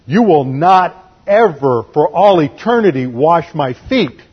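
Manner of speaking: slow, emphatic, every word weighted; firm, drawn-out insistence; adamant refusal. Retold or imitated. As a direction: spell out a flat refusal. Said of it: "he's just being adamant here".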